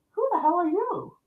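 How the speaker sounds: high-pitched, incredulous challenge; indignant startled demand. Retold or imitated. Woman confronting a strange man in her room, startled and indignant.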